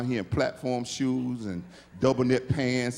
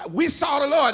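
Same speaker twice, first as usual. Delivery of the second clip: excited disciples; 12 semitones higher, 8 dB louder than usual. Retold or imitated. Disciples mocked, retold to Thomas.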